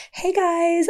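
high-pitched mock American greeting; bad American accent. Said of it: "I'm gonna do her accent really bad".

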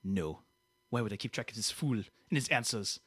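put-on indignant French-Canadian accent; sulky French-Canadian grievance; Quebecois [name]. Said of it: "Quebecois [name] over here".

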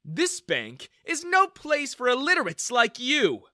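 high, loud contemptuous jeer. A narrator's reading. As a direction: look down on him. Manager insults customer.

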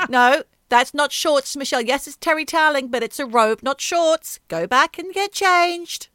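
high, loud scolding mother; stern mum. Retold or imitated. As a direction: tell her off like a strict mum. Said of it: "Did she say".

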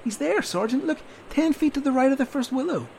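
high, eager pointing out; eager insistence. Acted. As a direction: urge the sergeant to look. Soldier insisting he sees target.

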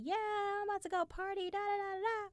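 bouncy party excitement; giddy self. Retold or imitated. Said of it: "I'm like".

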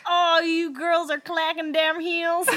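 loud, slow mock Southern drawl; high, hammed-up Southern American complaint; Deep South accent. Retelling a neighbour's noise complaint, exaggerated Deep South accent. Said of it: "the deep south of America".

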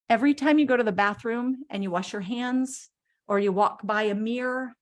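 ordinary talk, nothing marked

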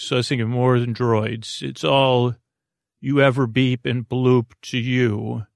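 ordinary talk, nothing marked

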